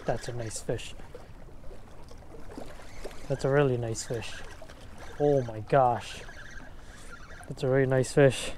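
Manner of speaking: breathless, quiet amazement; hushed awe. Real, not acted. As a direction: murmur in disbelief. Hushed awe at big fish.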